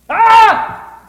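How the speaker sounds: sudden high yell; loud scream. Shown as a demonstration. Showing kids the loud part with a scream. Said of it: "times that it wants us to be really loud".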